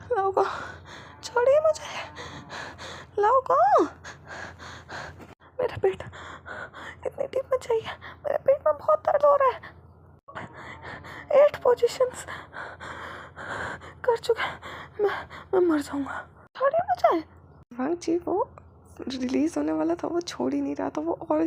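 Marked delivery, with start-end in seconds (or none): 0.1-7.9 put-on high, pained pleading young man; Wing's pleading voice. Wing begging his husband to stop, high and pained. 8.2-9.6 high, pained whimpering complaint; pained plea. Acted. Wing telling his husband his stomach hurts, high and pained. 11.4-17.2 put-on high, pained pleading young man; Wing's pleading voice. Wing begging his husband to stop, high and pained.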